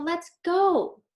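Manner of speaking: put-on high, loud, eager kid's voice; an impatient child ready to leave; eager little girl. Girl character voiced in story.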